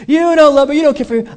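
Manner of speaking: high, loud mock accusation; hammed-up "poor me" complaint; mock quarrel. Acted quarrel, husband accusing.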